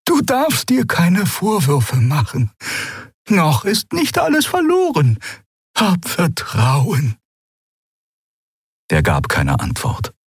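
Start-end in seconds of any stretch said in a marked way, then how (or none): 0.1-7.2 high, slow consoling female voice; soothing companion, slow and high; gentle priestess. A narrator's reading. Xea comforts Keru.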